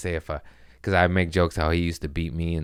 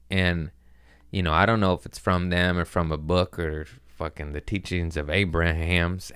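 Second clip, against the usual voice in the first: ordinary delivery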